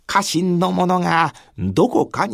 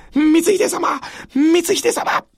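high, rising cries for the lord; desperate calling; 10 semitones higher, slower than usual. Acted. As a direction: call out in alarm. Retainers calling the wounded lord. (必死に呼びかける)

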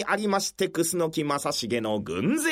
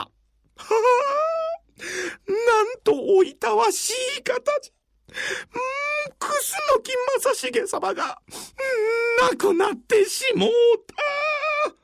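crying. Acted. Man mourning Masashige in tears.

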